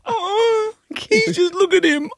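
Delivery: very high tearful whimper, imitated; mock crying wife. Husband mimicking his wife pitying a robot vacuum, mock weepy. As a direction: squeak it out as if about to cry. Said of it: "She's gonna cry".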